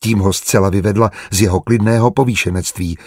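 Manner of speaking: ordinary delivery, nothing marked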